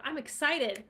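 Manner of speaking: playful excited demo; mock excited. Shown as a demonstration. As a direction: show what excited sounds like. Demoing excited.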